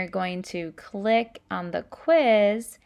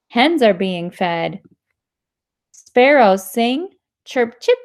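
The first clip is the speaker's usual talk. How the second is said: put-on louder, lively read-aloud voice for kids; reading to children; 8 dB louder than usual. Teacher reading a sound-word book to students, lively. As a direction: bring the story alive for the class.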